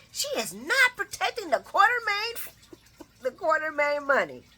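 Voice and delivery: high, indignant sing-song; high scolding with a laugh. Real, not acted. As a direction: scold her in a high outraged voice. Indignant about the money.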